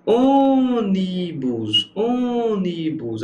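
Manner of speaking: Ônibus very slow, with a long, high "Ô"; Ônibus called out with a hugely stretched "Ô"; stress on the antepenultimate. Shown as a demonstration. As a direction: hold the first syllable of Ônibus, then drop. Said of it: "A sílaba tônica aqui é a antepenúltima".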